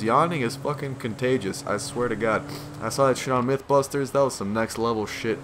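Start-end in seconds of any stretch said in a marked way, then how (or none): none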